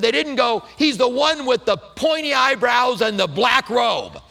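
tossing off a joke about Judas; mock disciple quote. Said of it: "and this is what I said in the".

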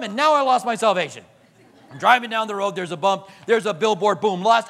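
usual speaking voice

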